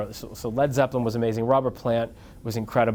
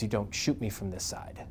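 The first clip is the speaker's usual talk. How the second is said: softer, firm quiet aside; hushed instruction; 3 semitones lower, 9 dB quieter than usual. Retold or imitated. [name] quietly instructing about the photographer, hushed. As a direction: warn him under your breath. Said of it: "she goes".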